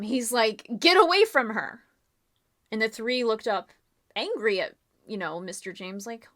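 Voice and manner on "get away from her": sudden loud shout, pitched up; yelling. Acted. James shouting at the three by the bodies, loud and high. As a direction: yell at them to back off. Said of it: "he starts yelling at them".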